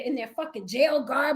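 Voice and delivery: ordinary talk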